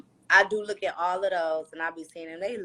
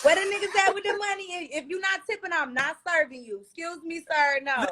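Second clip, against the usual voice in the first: higher, fast, laughing sassy bartender; 11 semitones higher than usual. Retold or imitated. Bartender telling off non-tippers, sassy and higher. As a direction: bark at cheap customers with attitude.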